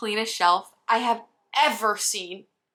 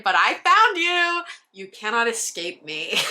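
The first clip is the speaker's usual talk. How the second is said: mock menacing; 2 semitones higher, 4 dB louder than usual. Teasing a hidden Twilight shelf.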